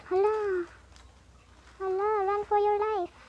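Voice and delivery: high-pitched warning cry; playful alarm. Real, not acted. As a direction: call out high as if being chased. Alarmed by chase in game. (taas nga singgit sa kakulba)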